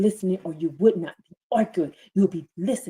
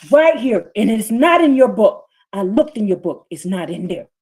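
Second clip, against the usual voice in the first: preaching at full force; 6 semitones higher, 7 dB louder than usual. Acted. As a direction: thunder the scripture over the congregation.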